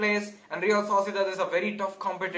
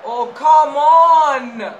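slow, loud, drawn-out groan of protest; exasperated yell; 6 semitones higher, 13 dB louder, much slower than usual. Real, not acted. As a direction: drag it out in disgust. Another offside call.

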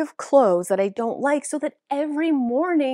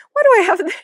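higher and louder, baffled; shocked at her own closet; squealing disbelief; incredulous yelp; 11 semitones higher, 11 dB louder, much faster than usual. Real, not acted. Finds old clothing in closet.

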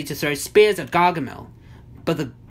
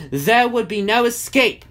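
ordinary delivery

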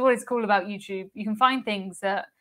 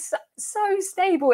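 amazed; 10 semitones higher than usual. Real, not acted. Amazed at Queen Mary stability.

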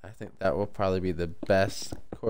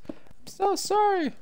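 very high, slow whiny sorry; falsetto mock apology; slower than usual. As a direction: beg forgiveness in a silly squeal.

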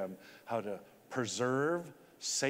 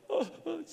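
gasp. Retold or imitated. Retelling hero's crying.

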